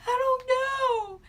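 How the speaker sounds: loud, high mock wail; hammed-up tearful outburst; imitated crying. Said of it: "I burst out and started crying".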